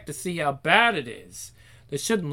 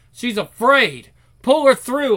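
loud; 6 semitones higher, 7 dB louder than usual. Acted. Bullies drag girl through door.

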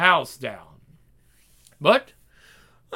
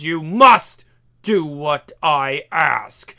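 ordinary talk

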